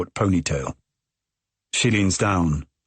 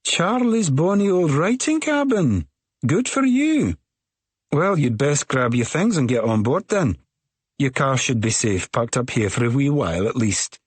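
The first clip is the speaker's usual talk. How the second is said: smiling Scottish woman; 7 semitones higher than usual. A narrator's reading. Tall ferry woman welcoming a stranger aboard, high and sing-song. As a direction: brighten into a high lilting welcome.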